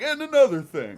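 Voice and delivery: high, loud laughing tirade opener; laughing mock complaint; mock ranting. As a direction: launch a fake rant. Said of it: "next time I'll be like".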